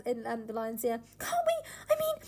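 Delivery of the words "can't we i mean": very high, strained shrill voice. Acted. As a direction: squeal it up high and pleading. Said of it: "You, you are shrill".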